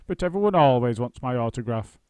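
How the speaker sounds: loud, astonished actor; genuinely taken aback; surprised. A narrator's reading. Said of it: "in a surprised voice".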